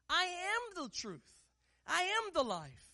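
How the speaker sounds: slow, high "I am", falling to the end; stress on I AM. Shown as a demonstration. Preacher declaring Jesus' words, slow and high on "I am". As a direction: lift and stretch "I am" each time. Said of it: "so here's the emphasis".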